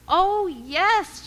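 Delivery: high, loud, delighted; joyful exclaim. Retold or imitated. Fayette answering the class, delighted. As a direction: burst out in delight. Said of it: "she'd say".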